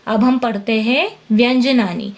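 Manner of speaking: usual speaking voice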